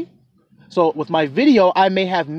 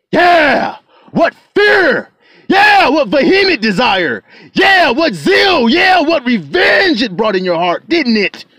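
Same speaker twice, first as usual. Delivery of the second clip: higher, louder shouted yeah after each phrase; full-force preaching; 8 semitones higher, 6 dB louder than usual. Acted. Preacher rousing the congregation, shouting at full force. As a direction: drive it home like a revival climax.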